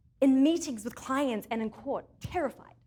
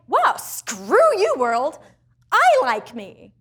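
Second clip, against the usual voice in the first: defiant; 9 dB louder, much slower than usual. Acted. Acting out defiant self-love.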